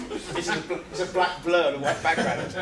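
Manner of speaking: laughing through the words; giggling while trying to read; real laughter breaking in. Real, not acted.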